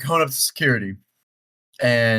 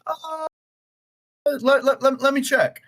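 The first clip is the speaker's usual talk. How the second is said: high, stammering nervous agent; flustered lady; 13 semitones higher than usual. Retold or imitated. Gate agent caught off guard by late passenger, high flustered stammer. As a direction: stumble out the words in a much higher voice.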